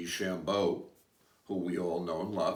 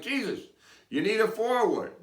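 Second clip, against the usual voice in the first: higher and louder, fed-up insistence; exasperated Moses; 13 semitones higher, 6 dB louder than usual. Retold or imitated. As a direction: push it out like a friend losing patience. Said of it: "Moses is like".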